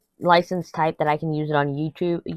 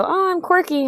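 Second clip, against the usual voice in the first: much higher cutesy voice; mock quirky person; 14 semitones higher than usual. Imitating people calling themselves quirky, high cutesy voice. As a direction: chirp it like a self-proud quirky type. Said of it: "they go".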